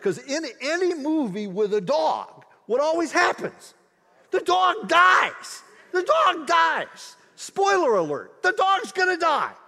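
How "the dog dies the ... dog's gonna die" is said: higher, louder exasperated wail; mock outrage. Preacher joking to the congregation about dog movies, mock despair. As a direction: throw up your hands at the cliché.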